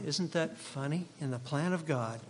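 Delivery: ordinary delivery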